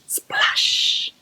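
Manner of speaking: "Splash" whooshed with a long hissing sh; onomatopoeic. Shown as a demonstration. Teacher showing a sound word, "Splash" drawn out into a hiss. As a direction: make "Splash" sound like the water, stretching the end. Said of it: "another one of our old onomatopoeic words".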